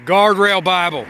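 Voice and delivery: loud, slow, drawn-out show title; title announcement. Acted.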